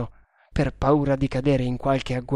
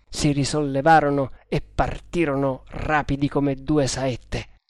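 plain reading voice

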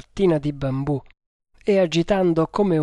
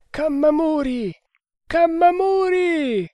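high, long-drawn distant call; crying out; 13 semitones higher than usual. A narrator's reading. A voice calling Kammamuri across the jungle, crying out. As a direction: call the name out high, stretching the end. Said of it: "gridò una voce".

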